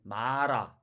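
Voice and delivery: 마라 with a Korean tapped R; Korean pronunciation. Shown as a demonstration. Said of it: "한국말로 비교해 볼게요".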